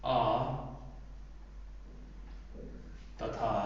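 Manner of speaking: word by word with long pauses; slow dictation. Shown as a demonstration. Teacher to students, slow note-taking dictation. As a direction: dictate slowly so they can write. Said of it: "उच्चारण".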